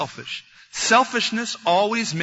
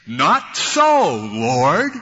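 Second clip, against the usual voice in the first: defiant refusal; 2 semitones lower, 6 dB louder, much slower than usual. Acted. Peter answering God's command to eat, defiant refusal. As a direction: refuse loudly, then drop to address him.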